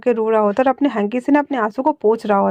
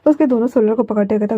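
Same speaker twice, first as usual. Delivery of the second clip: ordinary delivery, nothing marked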